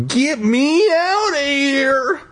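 squealing high panicked cry, slow and drawn out; high terrified wail. Acted. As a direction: wail it in terror. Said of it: "I thought".

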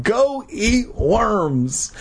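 very slow, high proclamation; hammed-up title line. Reader relishing the book's title, hammed-up proclamation. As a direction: drag out every word for effect.

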